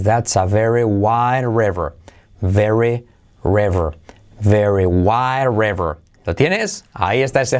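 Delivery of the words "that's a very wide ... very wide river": slow English model sentence for learners; slow demo. Shown as a demonstration. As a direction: say the sentence slowly and clearly. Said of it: "Ahí lo tienes". (frase modelo en inglés despacio)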